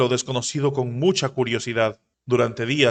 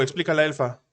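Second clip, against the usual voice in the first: ordinary delivery, nothing marked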